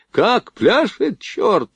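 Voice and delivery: high, slow, drawn-out exclamation; delighted admiration. A narrator's reading. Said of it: "восхищался Борейко".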